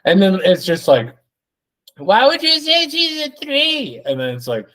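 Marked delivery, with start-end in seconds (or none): 2.0-4.0 squeaky high mocked guest; high falsetto mockery of a podcast guest; high mocking voice. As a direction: pitch way up for a dopey guest.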